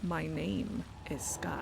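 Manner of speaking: slow, soft "Scott" with an A as in cat; name said with an A. Pretend. Gamer introducing the character, "Scott" with an A vowel. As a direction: say "Scott" as "Scat". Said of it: "It has to be pronounced like that".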